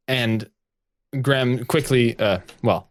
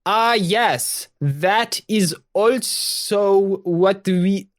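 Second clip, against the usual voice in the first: high, slow, drawn-out put-on accent; hammed-up fake accent; 10 semitones higher, much slower than usual. Viola posing as a local citizen to a stranger, fake accent. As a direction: stretch every word out in a badly disguised, lofty voice. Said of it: "You can just do your regular voice".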